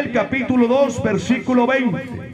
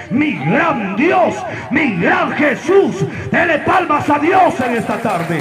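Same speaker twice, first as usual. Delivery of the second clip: preaching shout; 5 semitones higher, slower than usual. Acted. Preacher exalting God's greatness.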